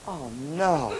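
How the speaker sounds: low, soft groan of dread; quiet dismay. Acted. Student learning it is ten till eight, dismayed. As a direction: let it sink low and hushed. Realises he has overslept.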